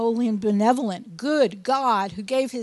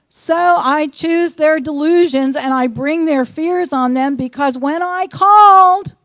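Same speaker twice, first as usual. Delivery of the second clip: loud, driving scripture reading; thundering pulpit reading; preaching at full force; 3 semitones higher, 7 dB louder than usual. Acted. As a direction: proclaim it like a fiery preacher.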